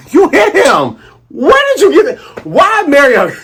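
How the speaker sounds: put-on high, loud, half-laughing girl voice; high indignant voice breaking into laughs; upset Kaya. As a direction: scold Mary in disbelief. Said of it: "And Kai's like".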